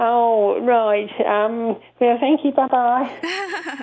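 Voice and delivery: high, flustered, prim goodbye; Robin Cooper voice. Retold or imitated. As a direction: back out politely in a fluster. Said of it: "he had a certain voice".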